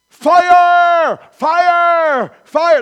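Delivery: full-throated alarm cry, over and over; shouting fire. Shown as a demonstration. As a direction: yell it like the room is burning. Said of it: "Do this. To the top of your lungs".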